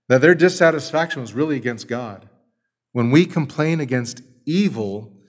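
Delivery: ordinary talk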